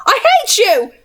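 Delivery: high, thin, trembling shriek. A narrator's reading. Jessamine shrieks at the goblin, high and trembling. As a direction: shriek it with shaking hatred. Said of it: "Jessamine shrieked, her voice thin and trembling".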